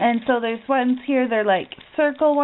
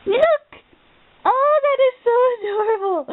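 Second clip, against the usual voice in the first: thrilled; 11 semitones higher than usual. Real, not acted. Seeing the phone charm, thrilled and teary. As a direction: gush over it, voice up high.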